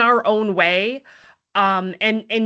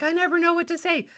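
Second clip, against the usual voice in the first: high, fast flustered voice; mock worrier; 8 semitones higher, faster than usual. Voicing a listener who never knows what to say, flustered. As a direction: sound helpless and rushed. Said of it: "who's like".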